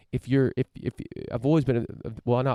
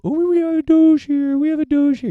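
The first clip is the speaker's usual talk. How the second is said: lampoon a snob pointing at a rare creature; mocking voice; 10 dB louder than usual. Mocking people who sneer at the heartland in a high, loud caricature voice.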